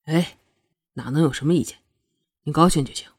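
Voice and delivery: low, placating male character voice; smooth her over, keeping the voice low; coaxing. A narrator's reading. Prince soothing the angry Shuangyue, coaxing. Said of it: "赶紧哄着双月说道".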